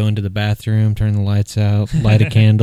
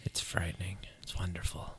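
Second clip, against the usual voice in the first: hushed awe; 3 semitones lower, 20 dB quieter than usual. Real, not acted. Hushed about a childhood movie.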